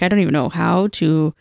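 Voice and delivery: ordinary talk, nothing marked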